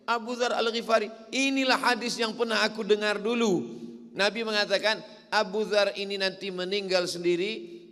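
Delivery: preacher in full flow, telling the story to the crowd; fiery preaching. Acted. Preacher tells the crowd of Abu Dzar's death, preaching loud and high.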